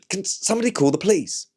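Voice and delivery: high, hammed-up posh cry for help; mock upper-class plea, pitched high; posh panic. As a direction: send it up high like a posh person in an emergency. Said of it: "someone could imagine that's how I would sound".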